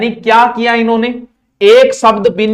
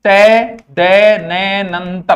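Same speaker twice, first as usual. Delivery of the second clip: तय दय नय नंतर very slowly, broken into letters; much slower than usual. Shown as a demonstration. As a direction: stretch each piece of तय दय नय नंतर. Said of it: "एक नए चेप गयो".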